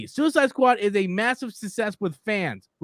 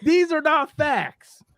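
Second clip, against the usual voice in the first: slow, higher-pitched insistence; exasperated; 6 semitones higher, 3 dB louder, much slower than usual. Real, not acted. Repeating the verdict on a commenter's claims, exasperated. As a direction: spell out your disbelief slowly.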